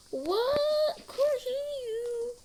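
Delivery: high, loud, slow "what, can't hear you"; shouted over the running water, teasing; mock deaf parent. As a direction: ham up a parent pretending not to hear. Parents mock not hearing.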